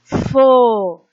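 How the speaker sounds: "Fo" held long and loud; stressed syllable. Shown as a demonstration. Teacher answering which syllable is strongest, "Fo" loud and drawn out. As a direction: call out "Fo" as one stretched, strong syllable. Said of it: "Foi a sílaba que eu mais demorei pra falar".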